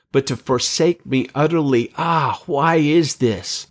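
anguished lament. Acted. Christ's forsaken lament, read aloud.

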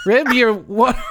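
put-on light New Zealand accent, half laughing; playful Kiwi rib for rub. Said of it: "more of a New Zealand thing".

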